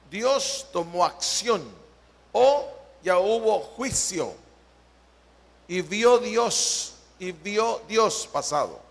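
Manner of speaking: normal talking voice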